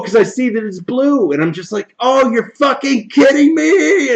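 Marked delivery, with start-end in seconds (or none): 2.0-4.1 exasperated disbelief. Retold or imitated. Retold disbelief while finishing fight.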